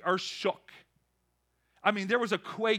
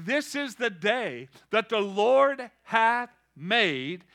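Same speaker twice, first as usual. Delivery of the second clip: reading; 5 dB louder, slower than usual. Acted. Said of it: "It says".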